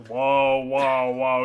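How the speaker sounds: loud, halting whoas; loud interjection. Real, not acted. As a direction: cut them off loudly. Loudly stopping the other speaker.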